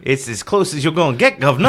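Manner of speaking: high, loud bad Cockney accent; mock Cockney. Cohost defending his bad accent, loud mock Cockney. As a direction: lay the fake Cockney on thick. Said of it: "That's his Cockney accent".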